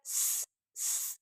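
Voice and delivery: a long voiceless स hiss; s sound alone. Shown as a demonstration. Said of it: "एस का sound". (बिना आवाज़ के स)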